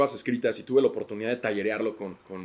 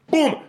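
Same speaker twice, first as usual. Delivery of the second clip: imitated bang; 7 dB louder than usual. Sound of being pushed.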